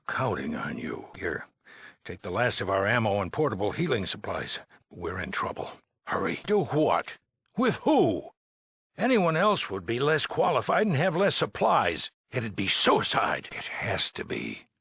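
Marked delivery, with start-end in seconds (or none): 6.5-12.1 high, exasperated disbelief; incredulous retort. Acted. Overseer rejects the dweller's suggestion, incredulous. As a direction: throw it back, pitch shooting up.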